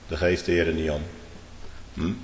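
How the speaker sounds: ordinary delivery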